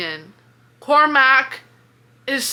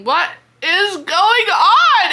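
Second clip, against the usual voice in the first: exasperated; 7 semitones higher, 5 dB louder, slower than usual. Real, not acted. Exasperated reader ranting about plot.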